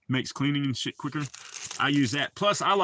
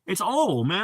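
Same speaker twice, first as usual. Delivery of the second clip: Southern oil; 5 semitones higher, 3 dB louder, slower than usual. Shown as a demonstration. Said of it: "I'm from the fucking South".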